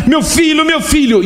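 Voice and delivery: chamado agudo e aflito; chamado desesperado. Acted. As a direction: implora pelo filho. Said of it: "começa a se desesperar". (pai desesperado chamando o filho)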